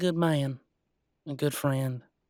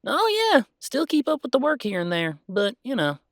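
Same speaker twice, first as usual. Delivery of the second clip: higher, breezy and pleased; excited; 10 semitones higher, 4 dB louder than usual. Acted. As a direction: toss it off brightly. Bright casual brag.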